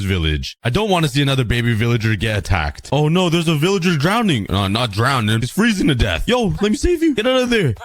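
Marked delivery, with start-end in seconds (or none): 6.3-7.8 much higher rescuing shout; urgent call. Real, not acted. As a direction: call out to save him fast. Rescuing a freezing villager.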